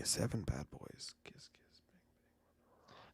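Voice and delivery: barely audible whisper, slow; mulling the picks under his breath; whispering to himself. Real, not acted.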